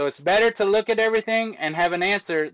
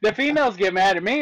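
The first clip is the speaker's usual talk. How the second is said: usual speaking voice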